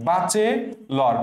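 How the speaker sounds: very slow "বাচেলর", opening on baa-che; "বাচেলর" with a broad aa, broken slowly; memory-trick pronunciation. Shown as a demonstration. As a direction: draw out "বাচেলর" as baa-che, then lor. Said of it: "মনে রাখবা, বাঁচে".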